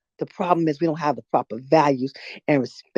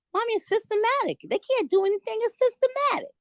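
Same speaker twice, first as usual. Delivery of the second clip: high, whiny mimicry of her daughter; mocking daughter voice; 3 dB quieter than usual. Mother mocks her daughter's graduation-day excuse in a high mocking voice. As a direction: parrot the kid in a much higher mocking voice. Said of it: "She goes".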